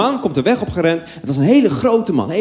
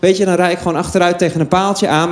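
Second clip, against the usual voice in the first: normal talking voice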